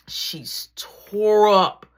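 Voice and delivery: very slow, loud, stretched "tore up"; "tore" dragged out and leaned on; drawn-out emphasis. Real, not acted. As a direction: stretch every word of "she's tore up". Mom drunk, stretched emphasis.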